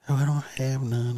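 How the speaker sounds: high, careless mock mom; mock mother voice. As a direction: shrug it off, high and flat. Mother answers.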